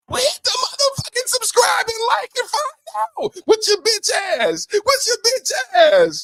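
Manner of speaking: hammed-up high yell; mock yell. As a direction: scream it at the viewers. High yelled plug.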